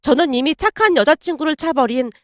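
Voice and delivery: normal talking voice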